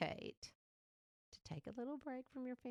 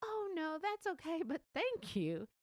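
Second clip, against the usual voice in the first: higher, sweet polite decline; polite refusal; 8 semitones higher than usual. Retold or imitated. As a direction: gently turn down the offer. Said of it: "And she goes".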